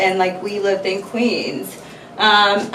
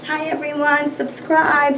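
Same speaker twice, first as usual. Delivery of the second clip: ordinary delivery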